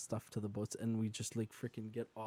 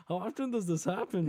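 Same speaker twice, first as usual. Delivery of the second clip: high, laugh-filled question; laughing disbelief; 8 semitones higher, 3 dB louder than usual. Retold or imitated. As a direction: ask through a laugh, amazed.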